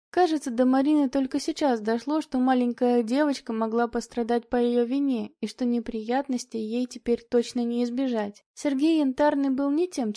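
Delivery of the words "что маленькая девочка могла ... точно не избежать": usual speaking voice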